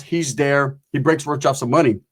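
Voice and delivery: normal talking voice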